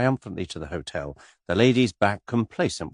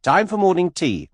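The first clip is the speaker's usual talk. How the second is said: louder, brisk guide call; 11 semitones higher, 5 dB louder than usual. A narrator's reading. Tour guide Anthony rounding up the ladies, brisk. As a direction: snap the group into motion. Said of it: "said Anthony briskly".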